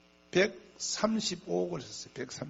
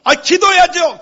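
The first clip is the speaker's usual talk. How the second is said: very loud, high acted answer; hammed-up dutiful shout; over-eager mock reply; 12 semitones higher, 19 dB louder than usual. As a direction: blurt it out, pretending to be wide awake. Acting his over-eager phone reply.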